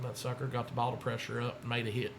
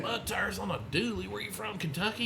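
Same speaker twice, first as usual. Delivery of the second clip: impressed onlookers; 8 semitones higher, 8 dB louder than usual. Retold or imitated. Boys walking round the truck and trailer, mock amazement. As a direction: lightly imitate amazed onlookers. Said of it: "They're walking around like".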